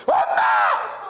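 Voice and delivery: drawn-out, unvoiced mock cry; a daughter-in-law faking grief for show; hammed-up pretend wail; fake theatrical wail. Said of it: "그런 걸 연극이라고 그럽니다".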